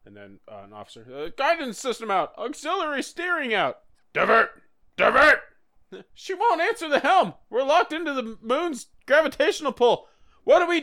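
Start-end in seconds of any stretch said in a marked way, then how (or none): 1.1-3.8 high, hurried mock panic; a crew officer yelling out the damage; panicked officer. Officer reporting damage, hammed-up high panic. 4.1-5.4 loud, high mock command; mock shout. Officer yelling at the helm, hammed-up loud panic. 6.1-10.8 high, hammed-up panic; mock panic. Crew officer pleading with the captain, high mock panic.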